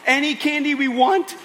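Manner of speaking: high, loud disbelieving question; amazed kids. Acted. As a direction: gasp it out like a stunned child. Girls amazed at candy.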